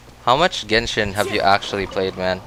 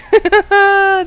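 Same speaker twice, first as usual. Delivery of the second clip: squealing giggle, high and loud; high delighted laugh; 7 dB louder than usual. Real, not acted. Delighted by weird creature.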